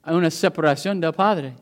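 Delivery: ordinary talk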